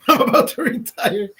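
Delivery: laughing through the words; chuckling repeat of the line. Real, not acted. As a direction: giggle it out high.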